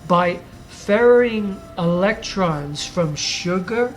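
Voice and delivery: ordinary talk, nothing marked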